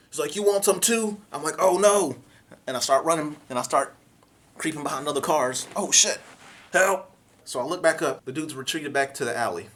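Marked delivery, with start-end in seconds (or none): 0.3-1.2 higher, louder menacing challenge; threatening shooter. Retold or imitated. Shooter turning on the narrator, threatening. 1.6-2.2 higher, louder frightened cry; scared yelp. Retold or imitated. Shooter turns toward him, frightened cry. 5.7-7.1 higher, louder frightened call; panicked cry for help. Retold or imitated. Hiding behind cars from the shooter, panicked.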